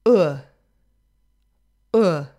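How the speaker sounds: "uh" as the vowel in "book"; isolated "uh" as in "should", for learners; vowel demonstration. Shown as a demonstration. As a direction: say "uh" rounded as in "put". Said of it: "It's found in".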